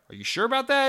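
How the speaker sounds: high-pitched mock doubt; skeptical questioning. Doubter answering all the hype and praise, skeptical.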